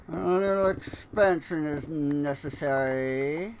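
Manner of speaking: a hint of a smug cartoon villain musing; Fat Cat villain voice. Gamer musing at his build in a mild cartoon villain voice. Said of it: "no idea what that accent is supposed to be".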